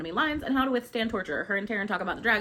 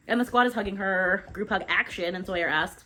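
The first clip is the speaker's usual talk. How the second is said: normal talking voice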